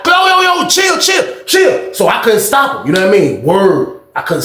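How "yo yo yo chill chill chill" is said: high-pitched pleading yell; frantic high holler, imitated; retold scream. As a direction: scream through a closed door to stop him. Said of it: "I'm screaming through the side".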